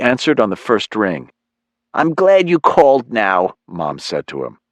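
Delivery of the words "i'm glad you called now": higher-pitched mom on the phone; mother's voice. Acted.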